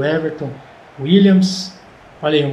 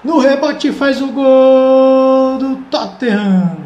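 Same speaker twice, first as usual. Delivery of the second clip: high, loud, drawn-out goal call; explosive goal commentary; 6 semitones higher, 5 dB louder, slower than usual. Real, not acted. Goal scored, commentator shouts.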